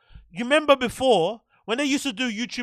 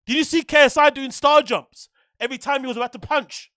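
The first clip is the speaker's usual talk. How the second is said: ordinary delivery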